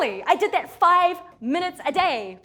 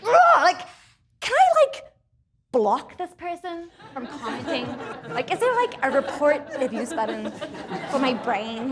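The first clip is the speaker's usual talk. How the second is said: exasperated; 4 dB louder than usual. Acted. Exasperated plea to herself.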